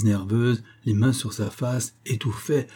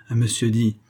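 usual speaking voice

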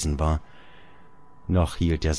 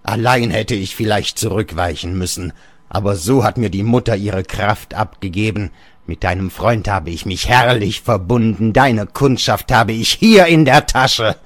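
triumphant father voice, rising louder at the end; scornful old father; 3 semitones higher, 6 dB louder than usual. Acted. Old father gloating over his son Georg.

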